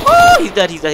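a sudden high yelp of triumph; surprised shout. Real, not acted. Gamer yelping as the enemy suddenly dies. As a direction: shriek it the instant he drops.

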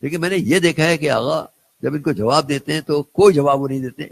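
ordinary talk, nothing marked

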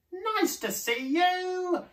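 very high, slow, loud greeting; high sing-song hoot. A narrator's reading. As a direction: hoot a welcome like a duckbill. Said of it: "and they hooted".

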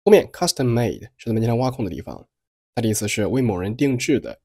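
ordinary delivery

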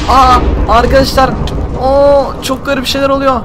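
high, loud cries of alarm; alarmed shouting. Acted. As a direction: yell it as everything goes strange. Dizziness effect in the game.

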